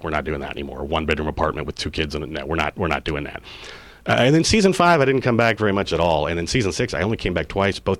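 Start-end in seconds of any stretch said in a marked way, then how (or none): 0.0-3.4 lightly voiced wife putting her foot down; firm refusal. Wife refusing another season in a one-bedroom apartment, quietly firm. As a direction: lower and softer, a hint of a flat firm wife.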